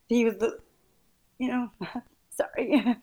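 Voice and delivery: choked up, fighting back tears; crying. Real, not acted. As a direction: voice breaking into tears. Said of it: "I'm sorry, I'm crying".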